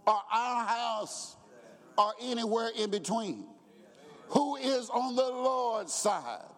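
rising, pleading preacher cadence; preaching. Acted. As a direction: build the call to the congregation. Preacher building intensity.

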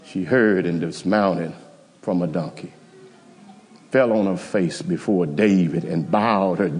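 low, soft, unhurried telling; hushed story narration. Acted. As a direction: draw the church into the quiet scene. Quiet storytelling passage.

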